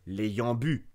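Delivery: ordinary delivery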